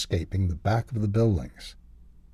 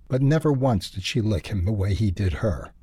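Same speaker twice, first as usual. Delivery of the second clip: plain reading voice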